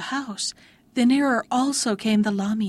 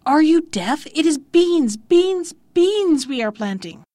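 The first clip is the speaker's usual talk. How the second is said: raised, higher, insistent retort; answering louder; 4 semitones higher, 5 dB louder than usual. A narrator's reading. Bean planters to the lamia, answering louder. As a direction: hammer it out loudly, dropping at the end. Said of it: "only answered the louder".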